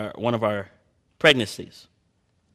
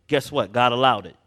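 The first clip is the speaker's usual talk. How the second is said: ordinary delivery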